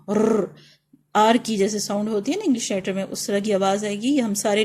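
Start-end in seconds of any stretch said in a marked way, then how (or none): none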